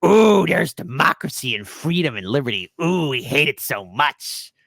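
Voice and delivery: mock evil society sneering over freedom; cartoon villain. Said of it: "like a Saturday morning cartoon villain".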